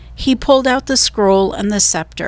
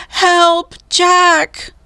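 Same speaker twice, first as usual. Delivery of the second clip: much higher, louder cry for help; distant cry for help; 10 semitones higher, 4 dB louder than usual. A narrator's reading. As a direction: scream for help from far away. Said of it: "Her cry seemed fainter".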